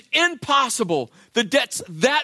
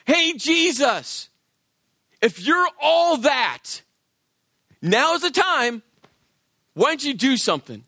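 mocking taunt; 6 semitones higher than usual. Thief on the cross taunting Jesus, mocking jeer. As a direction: sneer up at him from the next cross. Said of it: "he's laying into Jesus".

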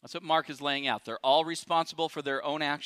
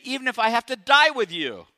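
loud, higher vow; vehement Peter; 5 semitones higher, 6 dB louder than usual. Retold or imitated. Preacher as Peter vowing loudly to die with Jesus. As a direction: insist loudly, almost shouting. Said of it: "he goes on to say".

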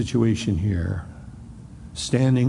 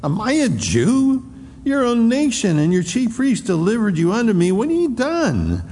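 high scornful taunt at a beaten prisoner; mocking Pilate; 10 semitones higher than usual. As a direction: sneer at him, much higher. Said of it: "And Pilate said".

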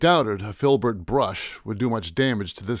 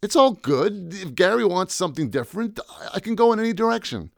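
much higher, rushed nervous stammer; 8 semitones higher than usual. Acted. Sweating painter to the goons, stammering. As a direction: stumble over the words in fear. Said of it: "I stammered".

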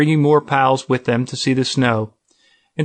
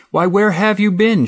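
high, bright surprised greeting; the receptionist cries out in surprise at seeing him; cried out; 8 semitones higher than usual. A narrator's reading. Surprised cry of welcome. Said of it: "she cried".

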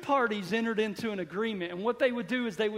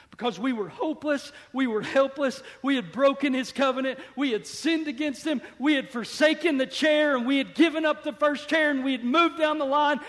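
building preacher climax; 4 semitones higher, 5 dB louder than usual. Acted. Preacher building to God's answer for the congregation, rising to full force. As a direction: pile up each line, climbing toward the shout.

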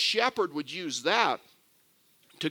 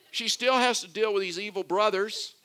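ordinary talk, nothing marked

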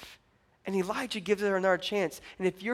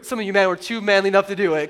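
usual speaking voice

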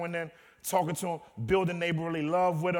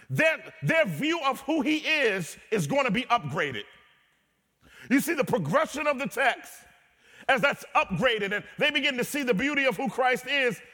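higher and louder, driving sermon cadence; full-force preaching; 8 semitones higher, 5 dB louder than usual. Acted. Pastor preaching to the church at full force. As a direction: preach it out to the congregation.

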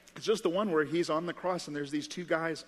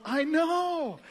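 high-pitched, gleeful mock disbelief; astonished agreement; 11 semitones higher, 4 dB louder than usual. Criminal in heaven agrees with his questioners, astonished. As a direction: exclaim it high, as amazed as they are.

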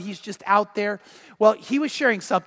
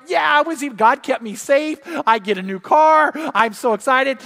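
higher, elated, playacted celebration; retold excitement; 4 semitones higher, 4 dB louder than usual. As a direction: act out a man bursting with glee. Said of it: "He's so excited".

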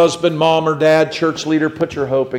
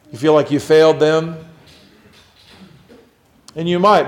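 ordinary talk, nothing marked

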